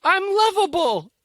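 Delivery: high, slow, gushing mock joy; mock elation. Acting someone with a first partner, mock elation. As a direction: exclaim it high and drawn out, hammed-up. Voicing a first-love realization.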